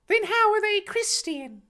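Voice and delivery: very high put-on boy voice; sceptical Harry. Harry questioning the Reverend, sceptically. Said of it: "Harry questioned skeptically".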